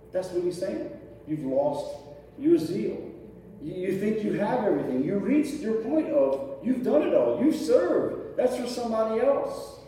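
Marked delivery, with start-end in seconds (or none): none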